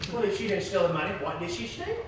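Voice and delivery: ordinary talk, nothing marked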